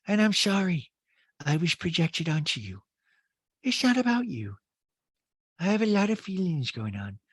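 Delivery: a touch of Rinna's tearful confession; Rinna imitation. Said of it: "my Rinna imitation".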